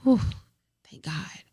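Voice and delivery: breathy whispered whew of relief. Retold or imitated. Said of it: "You're like".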